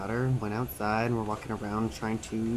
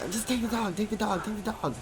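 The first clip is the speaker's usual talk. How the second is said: higher mock panic, urgent; panicked wife; 9 semitones higher than usual. Husband telling wife to take the dog away, mock panicked. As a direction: repeat it like a frantic plea. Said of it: "My wife starts screaming".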